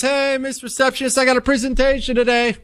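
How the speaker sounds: put-on high, eager kid voice; hopeful kid. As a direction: chirp it out hopefully. [name] voiced greeting receptionist.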